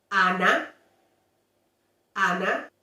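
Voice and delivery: Ana with clear open a; model Spanish. Shown as a demonstration. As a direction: Ana deutlich mit offenem a sprechen. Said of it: "the vowel A".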